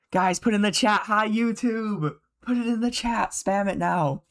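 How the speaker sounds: high-pitched hype call to chat; rallying the chat. Real, not acted. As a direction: urge viewers on in a high, drawn-out call. Streamer rallying chat to spam greeting.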